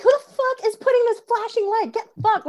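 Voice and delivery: put-on high, shouted drunk New Yorker outburst; drunk angry yell. Comedian retelling yelling at the light, high angry drunk shout. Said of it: "me, drunk New Yorker".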